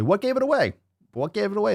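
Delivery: high-pitched mock surprise; mock incredulous. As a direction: squeal it as a sarcastic question. Mock surprise at being found out.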